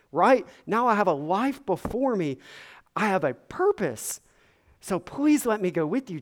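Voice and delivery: loud, high, eager begging; pleading excitement. Retold or imitated. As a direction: beg to come along, bursting with joy. Freed man voiced begging Jesus.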